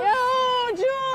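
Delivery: loud, high call to a friend. Real, not acted. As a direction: shout a greeting across the street. Greeting a friend across street.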